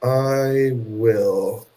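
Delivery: drawn-out announcement; slow and deliberate. Real, not acted. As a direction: stretch it out slowly.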